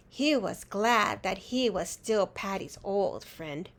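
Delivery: ordinary delivery, nothing marked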